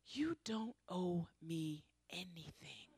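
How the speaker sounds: slow whispered line of disbelief; hushed retelling. Retold or imitated. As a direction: whisper it like a miracle. Retelling the man's surprising words.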